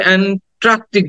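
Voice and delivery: "অ্যান্ট্রাকটিক" with an r slipped in after the t; "অ্যান্ট্রাকটিক" with a "tr" cluster; common mispronunciation. Shown as a demonstration. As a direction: say "অ্যান্ট্রাকটিক" wrongly as "aen-TRAK-tik". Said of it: "আর একটা ভুল আমরা করি".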